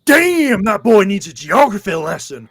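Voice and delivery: high, loud mock exclamation; hammed-up loud, high put-down; imitated heckling bystander. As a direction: holler it out in amused disbelief. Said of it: "this guy literally goes".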